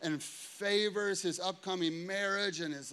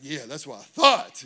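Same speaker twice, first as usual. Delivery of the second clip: louder, lower mock swagger; smug loud retort; 6 semitones lower, 8 dB louder than usual. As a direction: gloat as he drives off. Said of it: "I was like".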